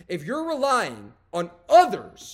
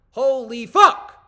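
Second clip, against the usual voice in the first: loud, slow "Holy fuck"; slow loud astonished curse; disbelief; 4 semitones higher, 9 dB louder, much slower than usual. Real, not acted. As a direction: drag it out, stunned. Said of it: "reacting in disbelief".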